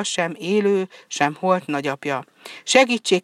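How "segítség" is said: kiáltás. A narrator's reading. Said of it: "kiáltotta".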